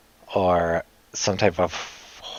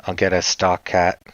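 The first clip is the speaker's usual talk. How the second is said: ordinary delivery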